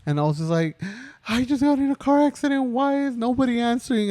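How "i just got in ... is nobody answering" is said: high, fast, near-tears retelling; panicked crying plea. Retold or imitated. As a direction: replay the panic of being stranded after the crash. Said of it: "I was, like, crying".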